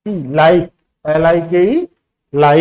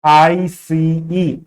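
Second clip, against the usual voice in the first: slow spelling of आई सी ई, each letter apart; a teacher spelling आई सी ई slowly; much slower than usual. Shown as a demonstration. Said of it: "अब इसको ई मत समझ लेना".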